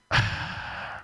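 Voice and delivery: imitating an impatient sigh, breathy and drawn out; loud impatient sigh. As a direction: sigh so the person knows you're waiting. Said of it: "that loud sigh that you make".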